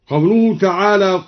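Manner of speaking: ordinary talk, nothing marked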